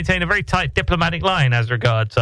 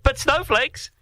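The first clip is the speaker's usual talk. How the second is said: mock cheery; 12 semitones higher, much slower than usual. Cheery tag on grim newscast.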